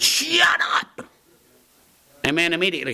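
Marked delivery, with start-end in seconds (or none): 0.0-1.1 put-on high, sudden yell to cut through a screaming kid; sharp shout. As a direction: bark it high to silence the child. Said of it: "I said".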